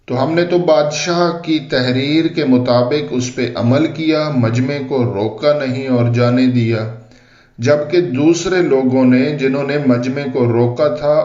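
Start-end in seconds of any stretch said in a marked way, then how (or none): none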